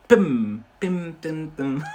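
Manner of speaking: playful "pim" snips, first one loud; imitated clipper noise. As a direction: mimic a clipper, a loud pim then smaller ones.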